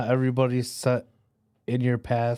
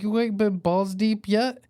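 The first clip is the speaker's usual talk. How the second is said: buddy's voice; 10 semitones higher than usual. Retold or imitated. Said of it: "He was like".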